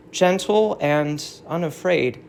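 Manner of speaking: ordinary talk, nothing marked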